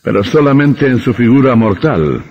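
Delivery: high and loud, savoring the secret; villain unmasking himself; menacing reveal. Acted. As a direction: announce it with dark glee. Vampire reveals his true self.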